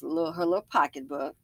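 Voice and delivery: ordinary delivery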